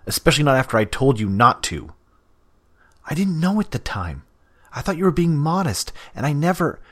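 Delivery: high, loud defensive rush; heated protest. Acted. Scratch protesting to Damien, high and heated. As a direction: protest over him, voice rising.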